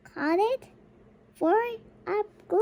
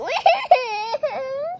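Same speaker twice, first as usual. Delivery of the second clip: child mimicking crying; 7 semitones higher, 3 dB louder than usual. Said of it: "she say".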